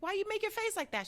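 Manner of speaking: high, quick retold question; fast, high-pitched acted puzzlement; puzzled questioning. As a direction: pitch it way up, mock-baffled.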